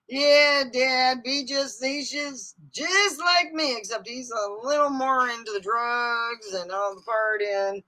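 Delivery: ordinary talk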